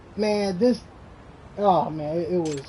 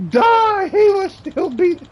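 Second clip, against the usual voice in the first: excited; 12 semitones higher, 5 dB louder, slower than usual. Real, not acted. Hyped retelling of the fight.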